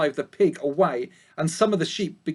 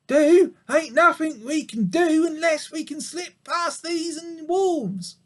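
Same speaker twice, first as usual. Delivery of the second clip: put-on old sheep voice; 11 semitones higher than usual. A narrator's reading. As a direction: chat like a gruff old sheep. Ma answers.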